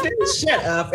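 playful brush-off through a laugh; laughing dismissal. Retold or imitated. Brushing off her brother's Al Roker joke, laughing. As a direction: laugh it off with a jump in pitch.

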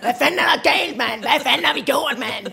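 teacher voicing the angry boy cursing. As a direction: snap like a furious kid. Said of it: "vrede sagde bare".

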